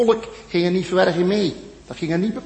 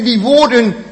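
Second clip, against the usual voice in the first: ordinary talk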